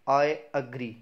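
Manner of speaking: "agree" as uh-GREE; correct version. Shown as a demonstration. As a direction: separate "I", then "agree" with schwa. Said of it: "इसको बोलते हैं".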